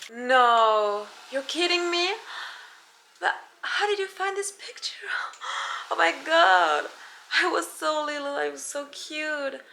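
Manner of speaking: put-on a star feigning wonder at an old photo; play-acted amazement. Pretends host shows childhood photo.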